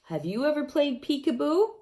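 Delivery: usual speaking voice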